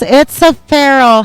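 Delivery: normal talking voice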